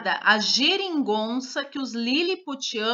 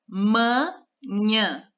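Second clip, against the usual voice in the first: manhã split into ma and nhã with a pause; syllable by syllable. Shown as a demonstration. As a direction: say manhã in two separate beats. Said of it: "duas sílabas". (manhã separando as sílabas)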